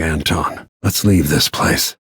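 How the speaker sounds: normal talking voice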